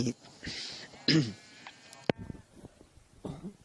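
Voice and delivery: wet cough from a sick chest. Real, not acted. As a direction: cough through phlegm. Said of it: "ก็ยังมีเสมหะ".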